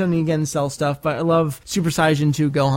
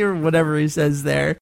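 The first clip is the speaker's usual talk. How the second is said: usual speaking voice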